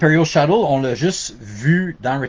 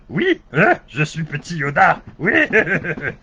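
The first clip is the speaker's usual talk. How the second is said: higher, sillier Yoda voice dubbed in French; a hint of a goofy French-dubbed Yoda; mocking French Yoda; 4 semitones higher, slower than usual. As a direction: lightly parody the bad French Yoda dub. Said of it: "In French, it's like".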